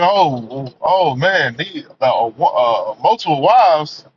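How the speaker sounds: lightly voiced girlfriend balking; Lois Lane. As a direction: stammer it out low and taken aback. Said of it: "she was looking like".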